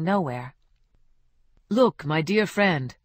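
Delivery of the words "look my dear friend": slow, lightly voiced Ramon; friendly counsel. Ramon advising Ruben, slow and friendly. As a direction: ease into advice like an old pal.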